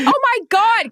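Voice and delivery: a genuinely thrilled outburst at a friend's big play; excited gasp. Retold or imitated. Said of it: "'cause I was so excited for you".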